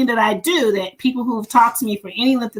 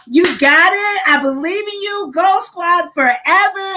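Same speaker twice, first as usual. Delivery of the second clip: pep-rally hype; 7 semitones higher, 3 dB louder, slower than usual. Retold or imitated. Hyping orientation crew.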